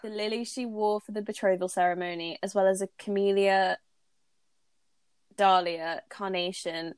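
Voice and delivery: ordinary talk, nothing marked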